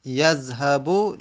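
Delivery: یذهبو drawn out slowly, syllable by syllable; slow model reading. Shown as a demonstration. (یذهبو را آهسته و کشیده بگو)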